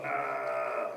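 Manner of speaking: very low, soft groan; deep dazed aah; pained groan. Acted. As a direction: moan like a sore gator. Alligator groans, rubbing bottom.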